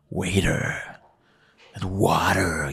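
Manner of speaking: soft put-on American accent saying "Waiter". Africans trying an American accent on the word "Waiter". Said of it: "tried the American accent".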